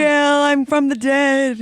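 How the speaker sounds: high, loud, very slow spooky moan; ghost voice. Pretend. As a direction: wail it out like a ghost. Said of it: "It's my ghost voice".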